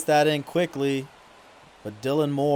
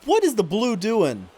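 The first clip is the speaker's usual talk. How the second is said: exasperated mockery; 7 semitones higher, 4 dB louder than usual. Real, not acted. Mocking the umpire's call.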